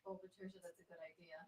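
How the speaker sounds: hushed aside whisper. Real, not acted. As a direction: murmur it under breath. Aside to chat.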